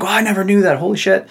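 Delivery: high, amazed gasp of a student; mock shock. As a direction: play the stunned freshman. Quoting students' shocked reaction.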